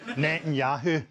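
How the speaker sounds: Netanyahu savoured in a broad Glaswegian accent; Scottish accent. Shown as a demonstration. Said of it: "one of the all-time great words in a Scottish accent".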